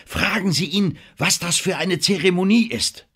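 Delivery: dringliche Anweisung, hoch gesprochen; befehlend. A narrator's reading. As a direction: weise den Kapitän eindringlich an. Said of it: "befahl Denham". (drängender Befehl mit hoher Stimme)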